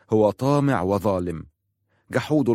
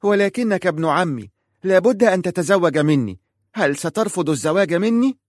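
put-on high, loud child's complaint; a girl pleading and grumbling, much higher and louder; petulant child voice, pitched high; whining little girl; 9 semitones higher, 4 dB louder than usual. A narrator's reading. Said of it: "فقالت في تذمر".

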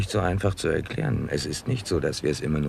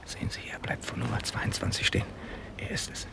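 hushed warning; whisper; 8 semitones higher, 5 dB quieter than usual. Acted. Nutting sending Dickens home, whispered. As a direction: keep it barely audible. Hiding, watching the house.

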